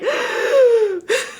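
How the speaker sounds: high, loud laughing gasp; sharp high gasp of feeling. Real, not acted. Overcome watching film.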